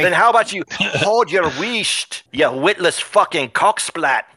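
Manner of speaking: mock Scottish sneer, hurling an insult; lightly put-on Scottish brogue, taunting; insulting Scottish accent. Dwarf villain insulting the hero in Scottish accent. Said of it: "He said it in a very insulting tone".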